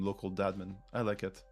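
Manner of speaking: usual speaking voice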